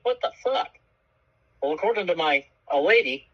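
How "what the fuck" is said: shocked what the fuck. Retold or imitated. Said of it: "and I was like".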